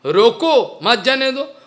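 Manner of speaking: falling "रोको", short pause, then "मत जाने दो" together; gap after रोको. Shown as a demonstration. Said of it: "इसमें थोड़ा गैपिंग है".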